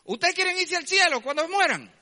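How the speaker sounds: high, loud hawker's question; the jeep man calling out to the domino players, loud and high. Retold or imitated. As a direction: pitch it like a street preacher.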